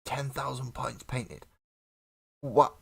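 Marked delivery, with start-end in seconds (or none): 2.4-2.8 sudden loud, high "What?"; surprised what. Real, not acted. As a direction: blurt it out in real disbelief. Reacting to the viewer's ten thousand points.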